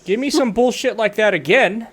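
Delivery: loud stern drill instructor; drill sergeant voice. Acted. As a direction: scold the cadet hard. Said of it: "your drill sergeant voice".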